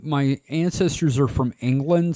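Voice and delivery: ordinary delivery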